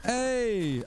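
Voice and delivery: sudden happy hey; excited greeting. Real, not acted. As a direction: cheer at the donation alert. Greets a new donation.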